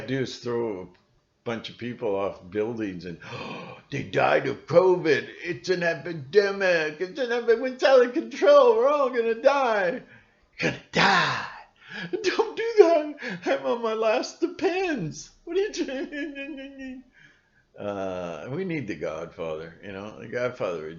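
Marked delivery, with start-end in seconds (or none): 3.9-11.5 high, loud, hammed-up panic; mock panic. Mocking media hyping covid deaths, hammed-up high panic. 12.1-17.0 high, laughing squeal; mock old lady squeal. Pretend frightened old person, high laughing squeals.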